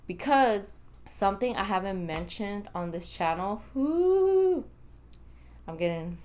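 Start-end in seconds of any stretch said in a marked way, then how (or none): 3.7-4.6 high loud whoop of nerves; real nervous whoo at the memory; excited whoo. Real, not acted. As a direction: let out a sudden high whoo. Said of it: "hyped up just thinking about it".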